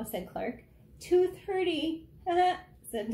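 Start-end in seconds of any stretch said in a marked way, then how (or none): 1.0-2.8 higher, louder cheery punchline; cheery dentist. Acted. Tiny dentist answering her riddle, a high cheery punchline. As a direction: deliver the joke brightly.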